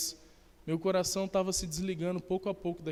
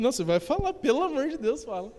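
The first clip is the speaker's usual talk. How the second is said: much higher, insistent begging; urgent pleading; 8 semitones higher than usual. Retold or imitated. Urging Suzana to tell the rest, high desperate plea. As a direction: demand it, desperate and pitched up.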